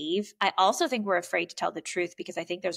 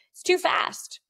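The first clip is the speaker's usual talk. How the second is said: higher, insistent "too fast"; mock firm complaint; 10 semitones higher, slower than usual. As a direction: press the point to the driver. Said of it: "And I was like".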